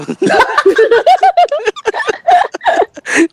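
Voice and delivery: very high, long peal of laughter; helpless laughter. Real, not acted. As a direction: dissolve into high giggling laughter. Laughs at the teasing nickname. (大聲笑袂停)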